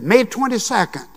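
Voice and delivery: "May twenty-second" lifted high, eager; proud announcement. Retold or imitated. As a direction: announce the date like big news. Proud answer before silence.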